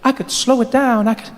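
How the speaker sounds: put-on high, halting young voice; still too fast. [name] admits he speaks fast, high and halting. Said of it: "Slower than that".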